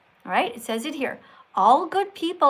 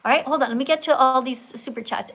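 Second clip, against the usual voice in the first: usual speaking voice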